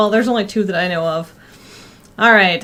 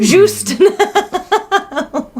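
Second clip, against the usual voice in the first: very slow, higher sultry brand name; sultry perfume ad; 8 semitones higher, much slower than usual. Pretend. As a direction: stretch the name out seductively. Mock perfume commercial.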